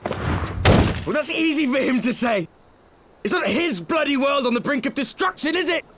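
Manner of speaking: high, indignant scoff at someone else's easy orders; exasperated outburst. Acted. Scoffing at the absent man's orders, exasperated and high. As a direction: throw it back, incredulous and sharp.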